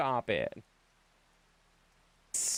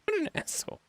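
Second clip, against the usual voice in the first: voiced dog; faster than usual. Voicing the dog again.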